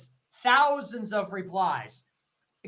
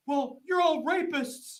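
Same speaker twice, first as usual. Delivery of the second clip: higher, slow, drawn-out mock accusation; mocking e-girl; 8 semitones higher, 3 dB louder, slower than usual. Streamer mocking e-girl who calls all critics rapists, high slow mock voice. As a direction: drag it out like a dramatic victim. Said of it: "If a thousand people reply".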